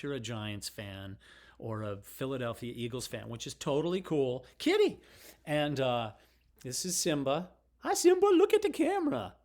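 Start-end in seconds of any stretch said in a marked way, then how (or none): 4.6-5.0 high, loud call of "Kitty!"; calling the cat. Real, not acted. 7.8-9.3 pet talk. Real, not acted. Talking to cat Simba.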